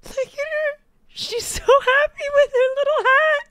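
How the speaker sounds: gushing squeal. Real, not acted. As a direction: coo it like seeing a puppy. Gushing over the hat designer.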